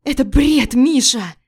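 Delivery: wife shouting at husband; desperate shout. Acted. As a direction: cry out his name.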